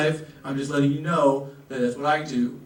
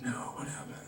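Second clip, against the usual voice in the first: hushed gossip; 4 semitones lower, 15 dB quieter, faster than usual. Retold or imitated. Whispered gossip reaction.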